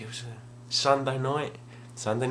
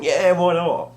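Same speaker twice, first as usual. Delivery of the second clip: higher, louder eager "why not"; excited yes; 8 semitones higher, 8 dB louder, slower than usual. Retold or imitated. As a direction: agree to anything, thrilled. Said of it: "'Cause I was so excited".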